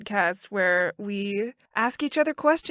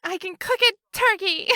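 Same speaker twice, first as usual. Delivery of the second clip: higher put-on woman's voice, laughing; strong woman voice; 8 semitones higher than usual. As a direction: play a proud woman, laughing. Continuing strong woman bit.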